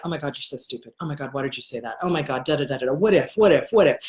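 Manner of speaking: low, running mutter of self-criticism; low nagging inner voice; inner critic chatter. Acted. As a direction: rattle off anxious self-talk under your voice.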